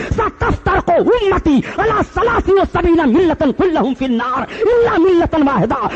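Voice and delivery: high-pitched, full-force sermon rebuke; fiery preaching. Acted. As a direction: build to a ringing, high-pitched exhortation of the congregation. (जोशीली, ललकारती तक़रीर)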